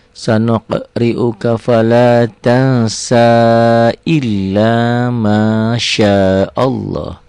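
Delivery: slow, drawn-out chanted recitation; Quran recitation. Shown as a demonstration. Teacher reciting verses for the class, slow chant. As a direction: intone the verses slowly like tilawah.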